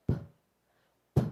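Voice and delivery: "P" as a short puff of air; "P" like blowing out a candle; puffed p sound. Shown as a demonstration. Teacher puffing the P sound like blowing a candle. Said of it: "Again, not P".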